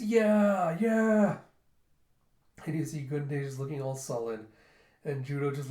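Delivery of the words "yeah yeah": loud, high drawn-out cry; retold cheer. Storyteller voicing an eager character's cry, a loud cheer.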